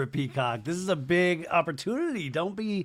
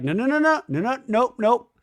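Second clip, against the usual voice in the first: high, loud rapid-fire nos; mock frantic denial; 8 semitones higher, 6 dB louder than usual. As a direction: imitate her shooting it down, pitched up. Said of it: "And Jasmine's like".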